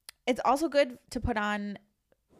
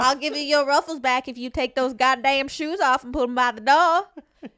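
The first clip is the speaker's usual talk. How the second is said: loud, hammed-up Southern bargaining; mock Southern scolding, louder at the end; Southern accent; 4 semitones higher, 8 dB louder than usual. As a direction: put on a Southern accent and pile it on. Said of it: "like, a southern accent".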